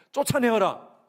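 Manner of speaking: loud, high mock order; mock command. Pastor mocking the harsh reaction, loud mock command. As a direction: bark it as the wrong answer.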